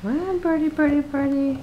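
high sing-song bird call; coaxing a wild bird. Real, not acted. Calling the bird louder.

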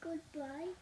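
plain reading voice

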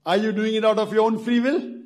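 normal talking voice

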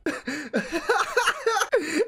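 high-pitched burst of laughter; long high cackle; laughing. Real, not acted. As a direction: crack up laughing. Laughing at rival's rant.

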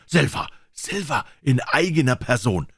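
high, astonished cry; surprised exclamation. A narrator's reading. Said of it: "mit einem Ausruf der Überraschung".

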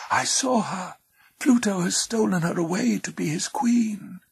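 high whispery river voice; whispering water. A narrator's reading. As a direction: let it ripple out in a high whisper. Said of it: "whispered the water".

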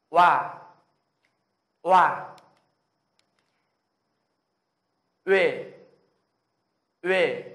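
loud, forceful vowel drill; full breath. Shown as a demonstration. Teacher models vowels for Korean learners, at full breath. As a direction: push each syllable out on a big breath. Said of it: "cứ lấy hơi hết sức ra".

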